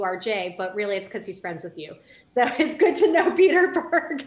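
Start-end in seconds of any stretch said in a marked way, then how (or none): 2.3-4.3 laughing. Real, not acted. Host teases cohost, laughing.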